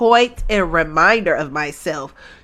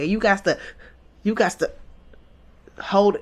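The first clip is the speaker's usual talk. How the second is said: usual speaking voice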